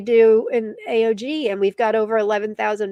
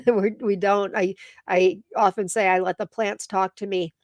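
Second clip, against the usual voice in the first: ordinary talk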